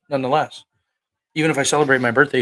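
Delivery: normal talking voice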